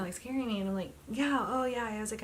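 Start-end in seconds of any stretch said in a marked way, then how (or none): 1.1-1.8 emphatic reply. Retold or imitated. Reassuring the alarmed technician.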